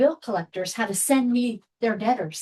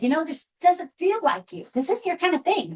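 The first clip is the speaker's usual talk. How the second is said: voiced higher as a gentle mentor; kindly mentor; 6 semitones higher than usual. Angel mentor warns her off bill collecting, gently higher. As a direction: quote the angel who saw through her, a touch lighter and higher. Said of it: "he came to me and said".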